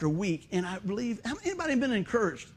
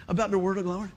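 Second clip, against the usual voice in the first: ordinary talk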